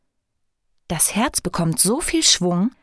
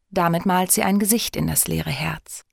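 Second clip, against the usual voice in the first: ordinary delivery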